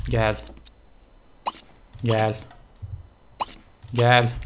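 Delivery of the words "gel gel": normal talking voice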